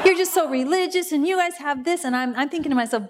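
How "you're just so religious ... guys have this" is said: mock complaint pitched well up; retold indignant griping; spewing accusations. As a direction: imitate an accuser spewing grievances. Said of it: "this people are spewing this".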